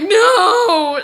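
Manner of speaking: loud, high mock-tearful wail; whining refusal. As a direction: refuse like a sobbing child. Crying refusal to leave bathroom.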